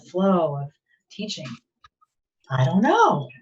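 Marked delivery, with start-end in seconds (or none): 2.5-3.3 playful ignorance. Retold or imitated. Voices teacher's amused mock ignorance.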